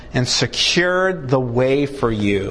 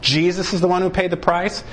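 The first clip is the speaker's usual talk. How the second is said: normal talking voice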